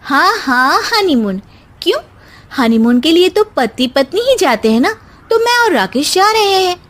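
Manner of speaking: lightly voiced young bride, playfully pointed; a hint of a wife gently needling her sister-in-law; Simmi teasing. (हल्का सा चिढ़ाती बहू)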